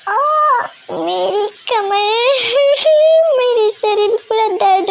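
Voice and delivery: high pained whine, slow at first; groaning in pain. Acted. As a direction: moan about an aching back. Body aching after night. (कमर दर्द से कराहते हुए)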